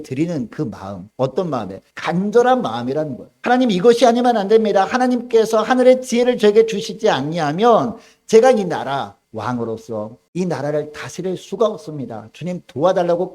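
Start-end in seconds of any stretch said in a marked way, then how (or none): 3.4-13.3 loud, high earnest cry to God; pleading prayer. Acted. Solomon begging God for heavenly wisdom, loud pleading. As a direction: beg God for wisdom as if everything hangs on it.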